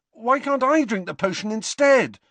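high, loud desperate plea; desperate protest, pitched high. A narrator's reading. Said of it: "asked Harry desperately".

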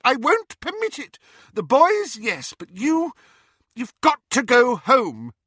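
high, loud motherly shout; mother yelling at her underage daughter. A narrator's reading. Mother shouting at her daughter, loud and high. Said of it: "Missus Weasley shouted at her daughter".

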